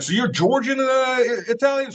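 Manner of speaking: high, puzzled imitation of the journalist; mock questioning. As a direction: play the journalist, feigning confusion. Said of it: "He's like".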